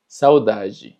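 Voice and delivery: saudade ending in "ji"; DE pronunciado ji. Shown as a demonstration. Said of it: "se pronuncia ji". (saudade con final en «ji»)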